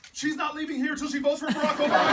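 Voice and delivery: high, loud punchline proclamation; loud declaration. Retold or imitated. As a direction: declare it forcefully to the whole room. Politician retelling his defiant punchline.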